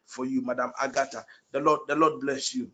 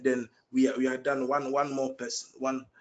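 normal talking voice

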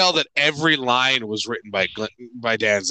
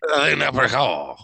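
mock French title; 3 semitones lower, slower than usual. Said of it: "mocking French".

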